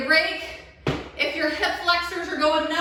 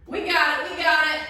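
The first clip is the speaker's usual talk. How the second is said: ordinary talk, nothing marked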